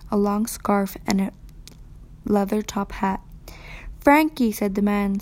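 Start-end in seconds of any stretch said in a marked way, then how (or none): 4.0-4.5 raised, louder surprised call of a name. A narrator's reading. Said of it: "sounding surprised".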